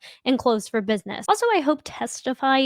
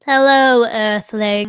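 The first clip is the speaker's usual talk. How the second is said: leprechaun voice; 3 semitones lower, 7 dB louder, slower than usual. Pretend. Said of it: "Why do I sound like a leprechaun?".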